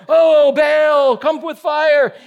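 high, loud pleading shout; mock shout to Baal. Preacher playing Baal's prophets begging for fire, loud high shout. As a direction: cry out to the heavens, hammed-up.

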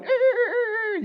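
very high squeaky hamster squeals; hamster squeaks. Retold or imitated. Preacher voicing hamsters on the wheel, high squeaks. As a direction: squeak like a tiny rodent.

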